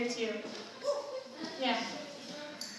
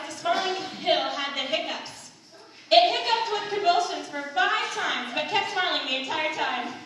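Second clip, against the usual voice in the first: ordinary talk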